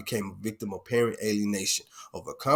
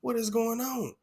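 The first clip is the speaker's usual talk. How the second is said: high confused; 11 semitones higher, 3 dB quieter than usual. Retold or imitated. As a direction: voice pitched up high in puzzlement. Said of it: "And I'm like".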